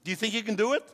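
normal talking voice

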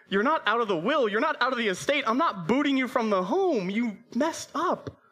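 high-pitched, loud, exasperated-sounding dad; retold dad's insistent, high-voiced pardon; emphatic reassurance. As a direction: pile up the denials up high. Father reassures son with mock intensity.

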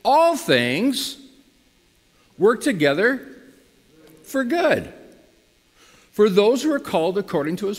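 preaching emphasis. Real, not acted. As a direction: preach it out with rising fervor. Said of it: "look at this".

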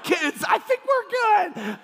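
put-on high, drawn-out yell to the kids; mock panicked shout. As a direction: holler over a shaking van, hammed up. Acts out calling to kids, speeding van.

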